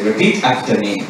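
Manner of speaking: slow, low German recital; lightly German-accented pimp dictating; German phrase recited. Retold or imitated. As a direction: drill it slowly like a pimp's lesson. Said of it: "pin us against the wall and say".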